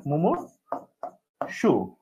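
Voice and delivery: মুমূর্ষু stretched very slowly, every syllable held; মুমূর্ষু said softly and stretched out long, as a memory aid; drawn-out demo. Shown as a demonstration. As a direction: draw মুমূর্ষু out syllable by syllable so it sticks. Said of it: "এটা হচ্ছে মনে রাখার technique".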